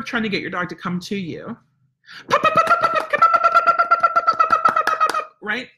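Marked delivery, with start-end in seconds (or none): 2.3-5.3 rapid, very high, loud repeated pops; excited, happy puppy-calling squeal; high-energy happy recall. Shown as a demonstration. Trainer calling a dog to come, high energy and excited. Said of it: "high energy, excited, happy, repetitive".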